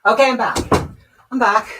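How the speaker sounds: loud, breathless return after a sprint; out of breath. Real, not acted. Streamer returns to viewers after sprinting through the house, breathless and loud. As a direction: burst back in panting from the run. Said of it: "I'm so out of breath".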